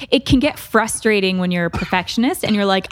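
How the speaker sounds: usual speaking voice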